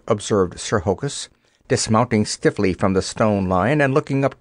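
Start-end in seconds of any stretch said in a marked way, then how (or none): none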